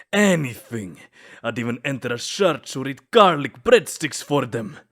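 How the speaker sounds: loud, rising vow; passionate declaration. Acted. Vampire swears to the human he'd do anything, loud. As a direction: declare it loudly, all in. Vampire vows devotion, escalating.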